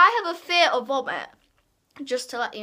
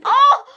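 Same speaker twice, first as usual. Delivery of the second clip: loud high gag of disgust; disgusted cry; 12 semitones higher, 11 dB louder than usual. Real, not acted. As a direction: let out a revolted yelp. Reacting to rotten egg taste.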